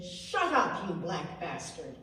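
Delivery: loud contemptuous yell; angry shout. Retold or imitated. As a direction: snap it at him with contempt. Sister-in-law abuses attendant.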